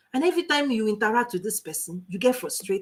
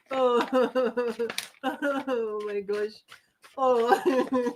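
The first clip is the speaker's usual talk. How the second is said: slow, breathless after laughter; laughing; 5 dB quieter, much slower than usual. Real, not acted. To the audience, recovering from a laughing fit, breathless. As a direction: catch your breath between giggles. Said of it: "I'm laughing".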